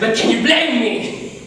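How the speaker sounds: laughing bitterly through the question; a wry, broken laugh at yourself. Acted. Narrator to listener, laughing bitterly.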